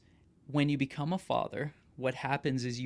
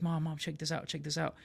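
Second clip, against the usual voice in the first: a touch higher, pestering mom for attention; lightly voiced eager child; 3 semitones higher than usual. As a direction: mildly imitate a kid calling mom over. Child eagerly calling his mother.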